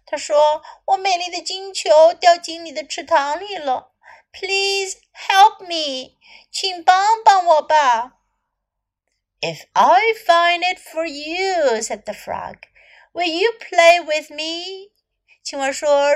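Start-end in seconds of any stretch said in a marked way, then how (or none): 0.9-8.2 begging sweetly for help; cartoon princess. 9.4-11.8 frog voice. Acted. Frog bargains. 13.1-14.9 frog voice. Acted. Frog bargains.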